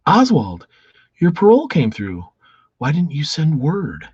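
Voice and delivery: put-on high, loud girlfriend voice; sweetheart.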